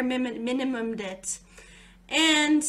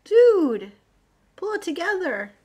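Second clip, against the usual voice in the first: mock self-scolding; 6 semitones higher, 7 dB louder than usual. Real, not acted. Scolding herself.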